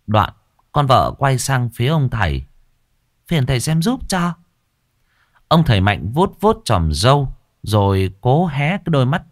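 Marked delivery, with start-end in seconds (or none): 3.3-4.3 higher, polite request; wife asking. Acted. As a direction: humbly ask the master for help. Wife asks the master.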